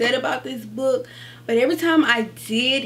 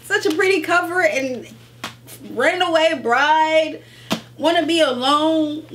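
gushing; 6 semitones higher, 4 dB louder than usual. Retold or imitated. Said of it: "has me like".

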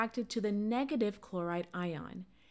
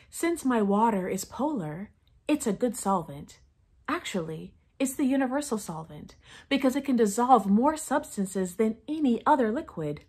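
infomercial pitch; 5 dB louder than usual. Acted. Fake sponsor ad read.

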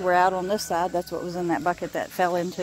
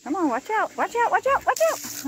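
high calling voice; 10 semitones higher than usual. Real, not acted. Shooing animals away from water.

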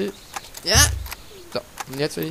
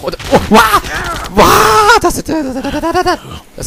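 schriller Schreckensschrei, viel höher und lauter; panisch schreiend; 11 dB louder, slower than usual. Real, not acted. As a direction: kreisch los, als ob die Horde dich gleich erwischt. Schreck bei Zombiehorde. (panischer Schrei vor der Zombiehorde)

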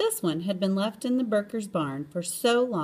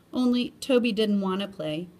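ordinary delivery, nothing marked

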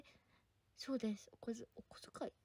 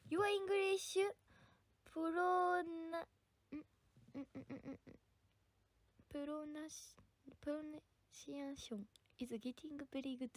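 slow, halting sounding-out of English; drawn-out, hesitant second-language reading; struggling to read English; much slower than usual. Real, not acted. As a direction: stumble syllable by syllable through the hard word. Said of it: "むずいて".

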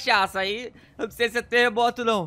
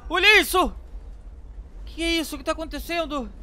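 astonished; 7 semitones higher than usual. Real, not acted. Gamer seeing the city shake, astonished cry. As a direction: gasp it out in disbelief.

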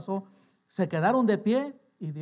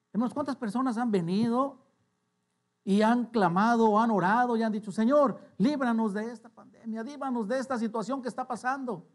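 raised, higher preacher's voice; forceful preaching; 7 semitones higher, 6 dB louder than usual. Real, not acted. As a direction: preach it out loud to the congregation. Preaches, acts out crying to God.

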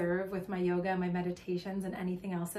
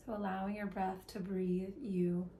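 guided meditation; 4 dB quieter than usual. Acted. Leading listeners into breath and body awareness, calm meditation voice. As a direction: guide them gently, letting phrases stretch and settle.

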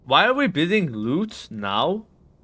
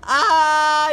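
long, loud, high "ah"; hesitant "ah"; 12 semitones higher, 6 dB louder than usual. Real, not acted. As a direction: draw out a high, loud wavering noise. Hesitates over a pricey bid.

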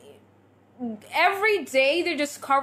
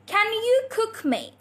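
slow, higher, drawn-out teaching delivery; tongue-twister demo; 7 semitones higher, slower than usual. Shown as a demonstration. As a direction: model the tongue twister slowly for learners. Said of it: "This is your next tongue twister".

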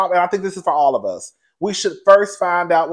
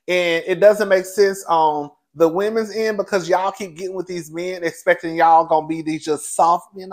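usual speaking voice

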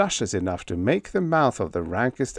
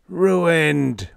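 wailed; 7 semitones higher, 5 dB louder than usual. A narrator's reading. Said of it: "wailed Cap Hansen".

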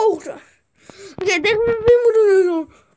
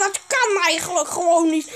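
ordinary talk, nothing marked

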